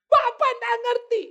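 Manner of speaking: high, loud, drawn-out wail; mock hysterical protest. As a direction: imitate an upset caller who feels misunderstood. Distraught caller protests to counsellor. (protes histeris dibuat-buat)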